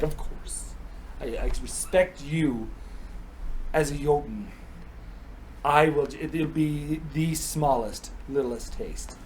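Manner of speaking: slow, soft reassurance with pauses; mock-earnest promise. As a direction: swear it gently, word by word. Odin solemnly promising a tiny taste.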